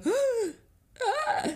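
sudden high-pitched shriek of shock; shocked scream. Retold or imitated. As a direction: let out a sharp, very high scream of panic. Seeing the immigration document.

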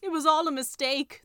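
high, loud wail; wailing tiger skin. A narrator's reading. Tiger skin answers how it came there, wailing. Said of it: "the tiger skin wailed".

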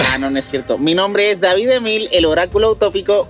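usual speaking voice

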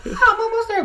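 high breathy mock moan; high mock woman, sighing; woman's voice. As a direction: squeak it out in a high mock sexy voice. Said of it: "She's like".

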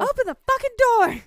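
very high, loud angry demand; hammed-up furious shout; furious yell. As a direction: scream it at the locked door. Friend joins acting babysitter yelling.

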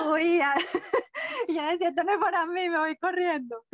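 high-pitched, giggly retelling; laughing. Real, not acted. Speaker recalling how she fled presentations, laughing. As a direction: tell it through helpless laughter. Laughing at memory of running away.

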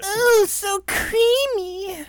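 very high, slow creepy croon. Acted. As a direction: stretch it out, eerie and high. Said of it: "that fucking creepy ass voice".

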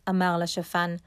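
ordinary delivery, nothing marked

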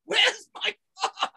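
high, slow furious shriek; mock furious mother shrieking at her son; mother screaming. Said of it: "And she's going".

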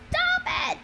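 high-pitched shouted command. A narrator's reading. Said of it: "she shouted".

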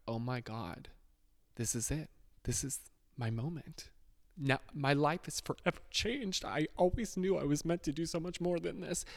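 3 gush like your big break just arrived; 1 mock starstruck excitement. 4 his past self, sure the brand email changed everything: mock starstruck. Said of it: "I remember being like".

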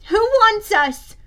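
high, loud, drawn-out demand; indignant girl insisting on an answer. A narrator's reading. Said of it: "Bianca demanded".

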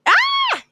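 short, high, loud squeal of exasperation; mock frustrated scream. Venting at her younger self, frustrated squeal.

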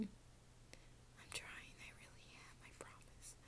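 whispered. Real, not acted. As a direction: breathe it out under your breath. Whispering to herself thinking.

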